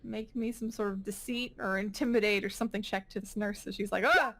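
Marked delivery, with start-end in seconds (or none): 4.0-4.3 high startled grunt; startled ugh. Acted. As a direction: jump back with a yelp. Nurse startled by intimidation.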